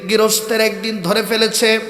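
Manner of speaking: normal talking voice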